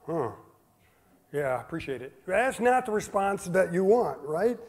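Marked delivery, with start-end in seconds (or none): 0.1-2.1 soft, flat mock shrug; unimpressed. Child opening an expensive gift, mock unimpressed. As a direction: deflate it, barely interested. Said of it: "open that present and go".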